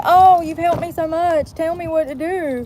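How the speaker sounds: high, slow, gushing mock voice; mocking grateful client. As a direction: gush like a needy client. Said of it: "First they say".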